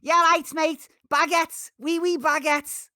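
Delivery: very high, loud cartoon French-Scouse accent; mixed French-Scouse. Imagining how the mix would sound, shrieky French-Scouse parody. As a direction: yelp it in mashed-up Scouse and French. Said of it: "like, a proper mixed mix".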